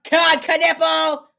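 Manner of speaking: high, slow stretched call; excited call. Real, not acted.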